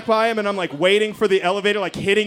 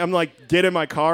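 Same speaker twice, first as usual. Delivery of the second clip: usual speaking voice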